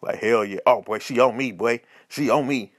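low-pitched sly aside; dropped-pitch confiding brag, not a true whisper; whispering homeboy. Acted. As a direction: imitate a friend murmuring a boast in your ear. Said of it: "your homeboy whisper".

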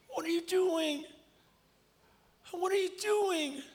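very high, strained anguished cry; distraught disbelief. Retold or imitated. Leader holding the mic as boy confesses, distraught. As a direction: plead in shock, voice pushed way up. Retelling own shock during confession.

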